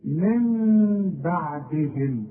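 من بعدهم with slow hummed meem on من; iqlab ghunna. Shown as a demonstration. Teacher recites example for class, slow ghunna. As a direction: hold the nasal ghunna. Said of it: "كمان كلمة".

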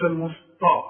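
المسطى with a loud emphatic t; wrong heavy ta. Shown as a demonstration. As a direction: say المسطى with a thick t. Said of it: "فما تقولش". (المسطى بطاء مفخمة)

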